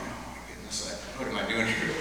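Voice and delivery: whispered, panicky aside to himself; nervous whisper. Retold or imitated. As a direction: breathe it out like a flustered guy at the counter. Nervous at the florist counter.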